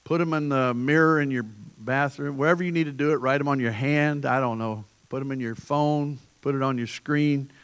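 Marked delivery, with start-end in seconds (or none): none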